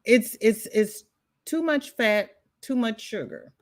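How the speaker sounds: normal talking voice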